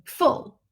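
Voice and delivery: full with a short U. Shown as a demonstration. Teacher models the short U in full. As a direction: clip the vowel in full short. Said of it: "it's a short U sound".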